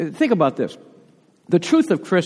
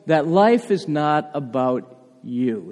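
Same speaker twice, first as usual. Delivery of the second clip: slow emphasis; 3 semitones lower, 3 dB quieter, much slower than usual. Shown as a demonstration. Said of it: "Watch this".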